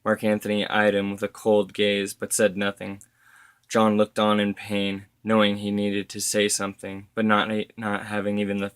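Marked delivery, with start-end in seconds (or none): none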